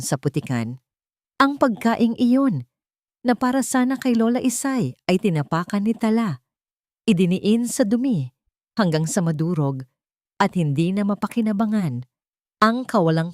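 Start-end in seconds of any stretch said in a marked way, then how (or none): none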